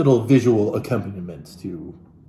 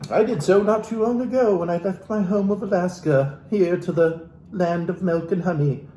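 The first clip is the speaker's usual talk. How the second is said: ordinary delivery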